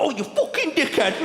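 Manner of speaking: high, sudden insult; mock outburst. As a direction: blurt it out. Quoting a rude outburst.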